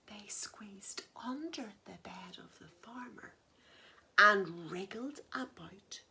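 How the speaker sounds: soft whispered narration; whispering the animals creeping upstairs; sneaky whisper. A narrator's reading. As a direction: whisper as if sneaking past a sleeper.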